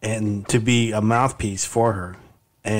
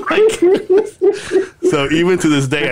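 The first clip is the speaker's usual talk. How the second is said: higher-pitched talk through laughter; laughing; 4 semitones higher, 4 dB louder, slower than usual. Real, not acted. Uncle amused by his running joke on nephew, laughing. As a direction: keep talking while cracking up.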